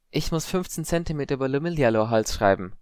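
high-pitched, tentative admission; hesitant girl. A narrator's reading. Padma accepting Harry's help, tentative. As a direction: give in after a breath.

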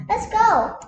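eager call. Acted. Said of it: "heroic call to go".

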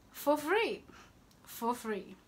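ordinary talk

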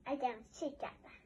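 normal talking voice

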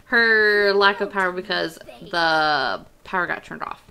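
loud, laughing explanation; joking about the power cut; amused, loud. Real, not acted. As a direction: crack up at the bills joke. Joking about unpaid bills.

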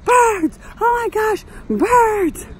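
high, loud yelps of alarm; retold panicked scream. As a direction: re-enact a screaming freak-out at birds.

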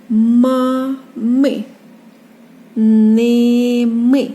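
Ни, ні, Ми, мі loud, each drawn out; reading syllables aloud. Shown as a demonstration. Teacher moving to the next syllables, Ни, ні loud and stretched. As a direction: hold the first of each pair, as in Ма, ми. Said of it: "Так, наступні склади".

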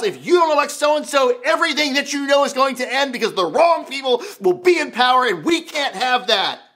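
urgent mock doomsday warning; alarmist political ad. Preacher mimics ads warning against the wrong people, alarmist. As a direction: sound the alarm like a campaign ad. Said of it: "every political ad you saw".

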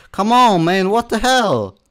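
very loud, high-pitched protest; exasperated shout. Real, not acted. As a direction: burst out yelling at the game. Exasperated outburst at the game.

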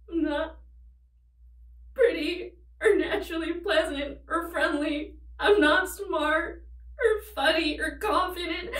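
crying through the words, half laughing; tragic heroine sorrow. Acted. Breaking down in her confession.

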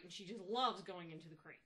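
ordinary delivery

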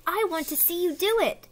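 high-pitched, eager pollywog; excited. Acted. Pollywog cannot wait to watch the spinning, excitedly. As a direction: pipe up with keen anticipation. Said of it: "the pollywog said excitedly".

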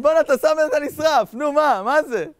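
high, loud incredulous outburst; loud disbelief. Retold or imitated. Nimrod seeing Abraham walk out unburnt, loud disbelief. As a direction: protest as if you can't believe it.

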